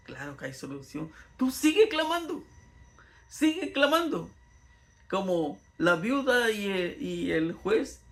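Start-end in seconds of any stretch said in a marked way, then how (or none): 0.1-1.1 a soft, hushed assurance. Real, not acted. Preacher reassures softly. 1.4-4.3 urging. Real, not acted. Preacher urges the congregation.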